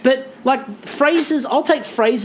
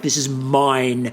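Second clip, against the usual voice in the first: slow, low, possessive; mock death stare; 6 semitones lower, much slower than usual. [name] guarding her ingredients, slow and low. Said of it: "this death stare".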